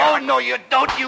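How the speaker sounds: very high, loud struggling yell; fighting shout. Acted. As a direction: grapple and yell. Fight breaks out.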